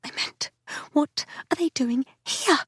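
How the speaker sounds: hushed unvoiced whisper. A narrator's reading. Narrator presses Tess behind the door, whispering. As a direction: whisper so no one hears.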